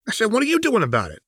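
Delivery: high, fast, mocking demand; pressing repeat, high and fast. Jinx pressing Echo again, high fast mockery. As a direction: rattle the question off at him.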